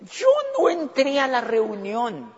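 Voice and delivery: slow, lightly voiced secret remark; understated conspiratorial quote; sly aside. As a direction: say it like a man hiding in a corner. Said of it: "y yo decía".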